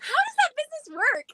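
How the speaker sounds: high excited imitation of husband; playful high mock of an eager husband; husband's excited reply. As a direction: pitch up into eager disbelief. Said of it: "And he goes".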